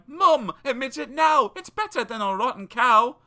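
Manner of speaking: put-on a boy crowing that he was right; Jack crying out. A narrator's reading. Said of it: "Young Jack cried".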